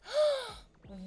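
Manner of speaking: loud, high gasp; sharp gasp. Real, not acted. Streamer reacting to Kuzenbert, gasping. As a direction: gasp in delighted shock.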